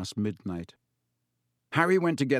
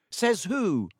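high-pitched, sceptical retort; loud challenge; 8 semitones higher than usual. A narrator's reading. Dean challenging the teacher in class, loud retort. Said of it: "said Dean Thomas loudly".

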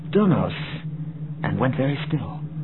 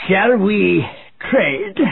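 high, loud commanding warlord voice; booming commanding warlord; 7 semitones higher, 4 dB louder, much slower than usual. A narrator's reading. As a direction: boom it like a warlord in charge. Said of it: "asked the warlord Zinj".

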